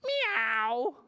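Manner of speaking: cat meow. Acted. Puppy George answering the vet, cat meow. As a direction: mew like a cat. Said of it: "George went".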